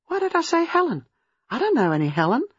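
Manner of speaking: high, agitated disbelief; alarmed confusion; bewildered. A narrator's reading. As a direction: question yourself, pitch leaping up. Baffled by her own answer.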